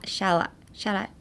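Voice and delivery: ordinary talk, nothing marked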